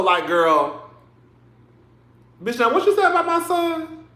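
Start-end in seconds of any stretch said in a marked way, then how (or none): 2.4-4.0 higher-pitched, sassy clapback from a protective mother; mama clapping back. Pretend. Dennis' mama snapping at the grandmother, higher sassy clapback. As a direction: rise up in pitch to defend her son.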